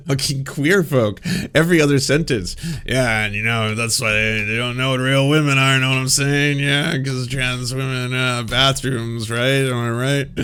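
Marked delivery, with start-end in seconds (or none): 2.8-10.4 mock right-winger. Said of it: "they don't sit down and just constantly".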